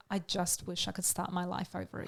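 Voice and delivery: hushed, understated heartbreak; quiet, hurt confession. Retold or imitated. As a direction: let it land softly, almost under the breath. Quoting hurt daughter to her father.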